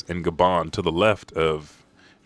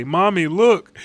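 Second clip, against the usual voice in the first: put-on excited child; 11 semitones higher, 7 dB louder than usual. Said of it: "they say".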